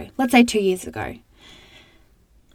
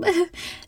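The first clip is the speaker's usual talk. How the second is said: short high teary laugh; a quick laugh to hold back tears; 10 semitones higher, 4 dB quieter than usual. Real, not acted. Laughing off tears while thanking her mum. Tearing up talking about mum.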